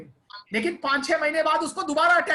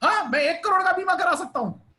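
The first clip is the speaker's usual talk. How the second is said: higher, delighted boast; pleased customer; 6 semitones higher than usual. Retold or imitated. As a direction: sound thrilled at the news. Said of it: "वो खुश हो जाएगा".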